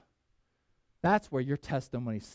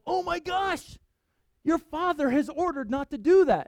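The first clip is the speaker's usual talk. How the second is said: alarmed soldier; 12 semitones higher, 5 dB louder than usual. Acted. Soldier voiced in retold story.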